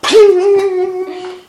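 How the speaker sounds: piercing high cartoon "Ping!" sound effect; sudden shrill cartoon clang. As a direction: ring out a Roadrunner-style pickaxe ping, very loud. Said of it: "Like in the Roadrunner cartoons".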